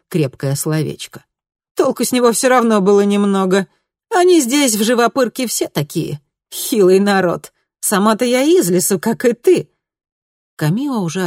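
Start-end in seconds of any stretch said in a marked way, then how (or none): 1.8-9.7 high, loud, cynical vixen; brash streetwise fox talking down the locals. A narrator's reading.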